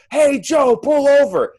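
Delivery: very high, full-volume acted scream; megaphone scream. Backseat driver screaming in the client's ear, megaphone scream. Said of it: "they have a megaphone screaming in your ear".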